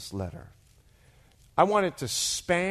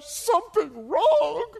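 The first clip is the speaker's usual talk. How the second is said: high, drawn-out mock wail; loud, slow, hammed-up distress; tearful outrage; 14 semitones higher, 7 dB louder, slower than usual. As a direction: imitate an upset woman wailing it back. Mimics crying wife's outraged reply.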